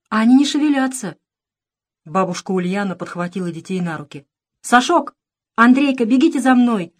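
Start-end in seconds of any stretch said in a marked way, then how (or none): 0.1-1.2 put-on high, raised child's call; thin boy's voice. A narrator's reading. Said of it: "послышался тонкий голос Андрейки". 4.6-6.9 high, loud urgent call. A narrator's reading. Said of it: "звала она".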